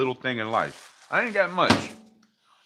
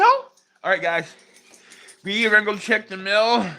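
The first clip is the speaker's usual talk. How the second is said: excited pet talk; 7 semitones higher, 6 dB louder than usual. Real, not acted. Hyping dogs up.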